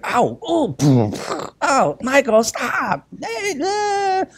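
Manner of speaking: put-on high whiny cries of boys roughhousing; kids whining in pain. Boys squabbling in their room, imitated high whiny yelps. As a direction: play the kid yelping as his brother hurts him. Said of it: "and you can hear".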